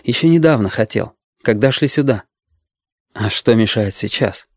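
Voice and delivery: usual speaking voice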